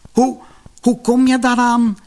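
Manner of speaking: the words barely get out for astonishment; stunned stammer. A narrator's reading. Wife sees the gold under the wood, stammering in amazement. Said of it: "wist ze er tenslotte uit te brengen".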